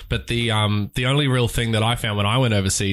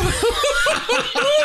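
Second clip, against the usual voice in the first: real high-pitched laughter at a pun; high whooping laugh. Real, not acted.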